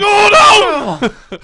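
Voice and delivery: very high, loud "oh no" scream; horrified shout. Real, not acted. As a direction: wail it in comic horror. Shocked reaction to picture.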